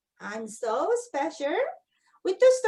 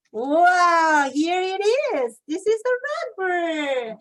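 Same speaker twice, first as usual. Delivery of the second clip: excited reveal; 4 semitones higher, 7 dB louder, slower than usual. Shown as a demonstration. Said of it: "revealing page to kids".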